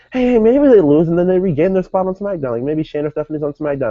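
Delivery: high, hopeful quoted thought; hopeful musing. Retold or imitated. Fan quoting own thought about a comeback, high hopeful musing. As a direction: pitch it up as a bright idea striking.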